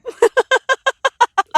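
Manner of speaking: loud, high imitation of her friend's cackle; imitated cackle. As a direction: cackle big like the friend did. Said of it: "she started laughing".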